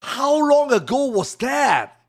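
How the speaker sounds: loud, high, slowed disbelief; stunned he left it on screen; holy shit moment; shocked realization. Real, not acted. Realizes ACT was on screen.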